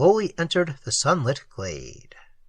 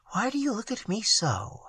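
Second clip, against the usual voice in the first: a little troubled; 6 semitones higher, 8 dB quieter than usual. A narrator's reading. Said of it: "a little troubled".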